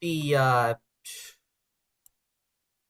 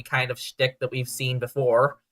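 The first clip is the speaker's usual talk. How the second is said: ordinary talk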